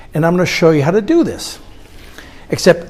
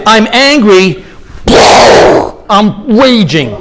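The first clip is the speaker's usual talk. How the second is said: louder, slower demo of rage; mock explosive rage, louder and drawn out; staged rage; 4 semitones higher, 5 dB louder, slower than usual. Shown as a demonstration. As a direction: lean into the words like exploding anger. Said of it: "Is it".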